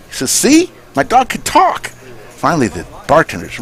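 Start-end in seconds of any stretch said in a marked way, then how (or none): 0.3-1.9 much higher gloating owner; high mock-triumphant owner. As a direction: crow it to the bartender. Owner quoted in joke.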